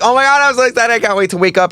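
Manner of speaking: high, giddy and excited; excited squeal. Retold or imitated. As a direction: squeal like you can't wait. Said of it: "you're like".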